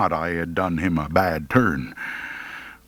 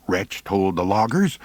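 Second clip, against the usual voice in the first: ordinary delivery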